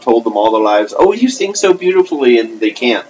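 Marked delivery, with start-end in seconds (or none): 1.0-2.4 mock gushing. Mocking gushing relatives.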